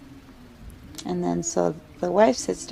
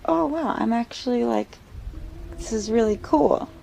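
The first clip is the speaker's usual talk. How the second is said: delighted surprise; 5 semitones higher than usual. Acted. Wife feeling baby kick.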